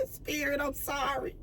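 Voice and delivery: real tearful apology, barely audible; crying apology. Real, not acted. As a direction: whimper the apology through tears.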